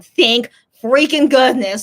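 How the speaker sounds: emphatic relief. Real, not acted. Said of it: "relief after chaos".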